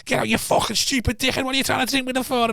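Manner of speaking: retold rant at a drunk boyfriend; sister fuming. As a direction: imitate a livid sister scolding. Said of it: "she was fuming with him".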